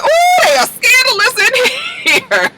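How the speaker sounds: very high, hooting mock gasp; scandalized delight. Lulu reacts to the exposed affair, imitated scandalized squeal. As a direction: act out gleeful scandal, way up high.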